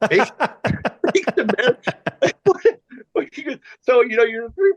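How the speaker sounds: high-pitched, real laughter through the words; words breaking into laughs; cracking up. Real, not acted. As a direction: try to talk while helpless with laughter. Cracking up over the catchphrase.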